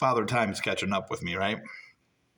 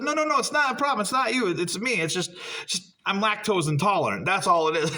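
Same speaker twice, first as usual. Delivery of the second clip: high, hurried backpedaling; frantic reassurance; 7 semitones higher than usual. Retold or imitated. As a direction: scramble to take it back, pitched up. Panicked reassurance to girlfriend.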